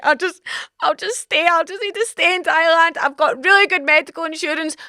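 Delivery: put-on sick girl pleading to stay in Thailand; pitiful pleading. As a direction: beg the friends to leave you behind.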